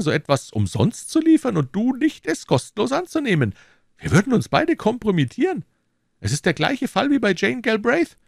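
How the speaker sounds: höher gesprochener, drängender McFarland; überredet den Freund mit Nachdruck; McFarland, pushing hard. Acted. McFarland voiced in story. (McFarland drängt Fettes, höhere eindringliche Stimme)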